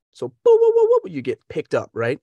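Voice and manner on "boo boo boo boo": high, loud booping pings; radar pinging. Acted. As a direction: imitate a radar with sharp rising boops. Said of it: "on a radar".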